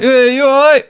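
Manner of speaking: dad tapping the window, chirpy hello; mock cheery greeting.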